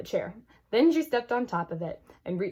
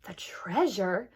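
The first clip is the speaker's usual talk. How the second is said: awed whisper; much slower than usual. A narrator's reading. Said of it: "his eyes widened".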